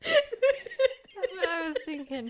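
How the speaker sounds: slow, wheezy giggling words; laughing too hard to talk. Real, not acted. Both giggling at the name.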